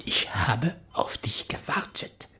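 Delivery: slow breathy whisper near his ear; eerie whisper. Acted. Reverend leans over Val in the bread basket, whispering slowly. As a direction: whisper it slowly to the man in the coffin. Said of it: "Ich trat zu ihm und sagte".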